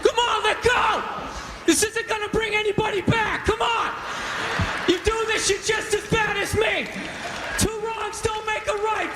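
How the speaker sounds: high-pitched mock panicked shrieking; screaming like a little girl. Condemned man clinging to his toilet, comic girlish screaming. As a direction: plead frantically in a hammed-up girlish scream. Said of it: "screaming like a little girl".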